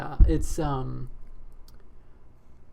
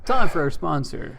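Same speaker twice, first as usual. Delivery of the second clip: announcer boom; 9 dB louder than usual. Acted. Announcing the sponsor break.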